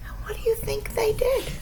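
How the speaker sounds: high, fast whisper; suspense whisper. A narrator's reading. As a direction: lean in and whisper the question. Storyteller builds suspense.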